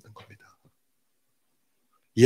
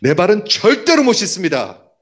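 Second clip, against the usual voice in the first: higher, louder protest, 절대로 punched; forceful refusal; 6 semitones higher, 4 dB louder than usual. Retold or imitated. Peter telling Jesus he will not be washed, forceful refusal. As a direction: refuse emphatically, voice jumping up. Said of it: "굉장히 강하게 얘기한 거예요".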